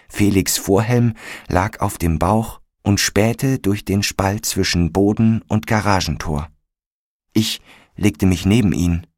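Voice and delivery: ordinary delivery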